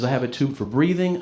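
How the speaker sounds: ordinary talk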